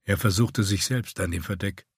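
usual speaking voice

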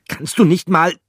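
high, loud, annoyed mouse; irritated mouse voice. Acted. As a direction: snap at a clumsy friend. Mouse character snapping.

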